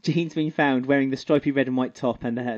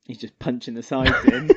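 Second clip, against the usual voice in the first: normal talking voice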